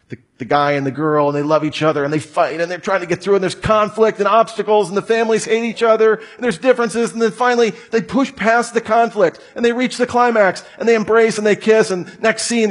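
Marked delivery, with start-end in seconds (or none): 2.6-12.7 building breathless excitement, pitched up; excited. Real, not acted. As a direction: rush through the plot like a trailer. Excitedly retelling a movie plot.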